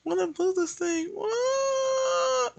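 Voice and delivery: high frustrated cry; high whine. Real, not acted. Artist struggling to move a layer, high whine. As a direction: squeal it in frustration.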